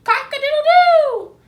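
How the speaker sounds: loud high rooster crow; horse crowing. A narrator's reading. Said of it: "and crowed".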